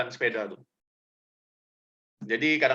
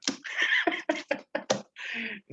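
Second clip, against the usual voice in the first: light laugh; 12 semitones higher, 4 dB quieter than usual. Real, not acted. Laughs at own cheek.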